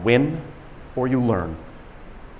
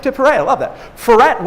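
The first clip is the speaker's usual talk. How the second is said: ordinary talk, nothing marked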